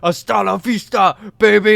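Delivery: put-on action-hero catchphrase voice; hammed-up movie line, punching the last word loud; Tracy Morgan quoting movies. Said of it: "Tracy Morgan's like".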